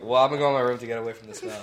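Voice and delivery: loud, quick comeback in a story; retold retort to mom. Kid telling mom they're escaping the smell, loud retold retort. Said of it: "And I was like".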